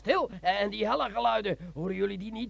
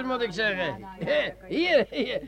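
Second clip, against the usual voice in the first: ordinary delivery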